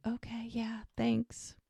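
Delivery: soft, hushed acted answer; whispered reply. Mel answering his offer of food, softly whispered. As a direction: drop to a near whisper.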